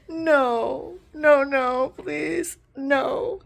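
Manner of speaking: high, loud cries of no; frantic high no, near tears; desperate pleading. Real, not acted. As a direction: beg them not to take it. Pleading against losing her love.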